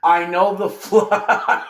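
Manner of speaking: slow words cracking into a laugh; breaking into laughter. Real, not acted. Listing rivals to fans, laughing mid-word. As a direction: try to stay serious and lose it. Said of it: "I can't even say that with a straight face".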